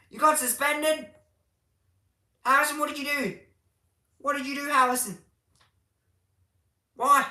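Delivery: high, loud disbelieving questions; mock outrage. Real, not acted. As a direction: grill him in playful shock. Questioning suspended viewer in mock shock.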